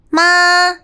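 まあ held high and level like Chinese first tone. Shown as a demonstration. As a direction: sing まあ on one high flat pitch. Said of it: "まあって言うじゃん？ 中国の発音。".

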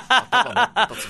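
laughing loudly through the words. Real, not acted. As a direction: burst out laughing on it. Excited joke, laughing.

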